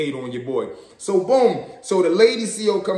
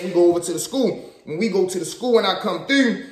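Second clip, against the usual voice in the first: normal talking voice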